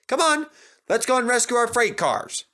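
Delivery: high, jolly drivers calling out; rally them along brightly; cheerful drivers. A narrator's reading. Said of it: "laughed their drivers".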